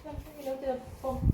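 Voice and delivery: ordinary talk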